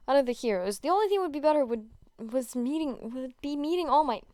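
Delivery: ordinary delivery, nothing marked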